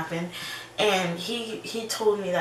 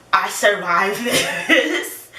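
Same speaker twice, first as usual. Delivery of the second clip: relieved laugh; 3 semitones higher, 7 dB louder, slower than usual. Real, not acted.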